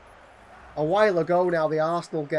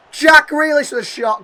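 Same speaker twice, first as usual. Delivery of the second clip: much higher and louder, rising to the shot; excited chance call; 11 semitones higher, 16 dB louder than usual. Real, not acted. Gamer commentating Grealish's shot, excited and loud.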